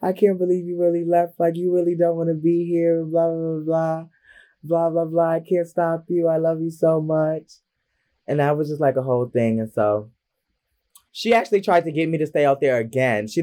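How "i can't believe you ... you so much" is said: higher, sustained mock-crying whine; mock bawling. Mother pleading with the child who left, lightly mocked crying. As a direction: imitate a crying mother in a high, drawn pitch. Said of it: "like, bawling, crying".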